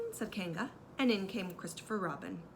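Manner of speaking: usual speaking voice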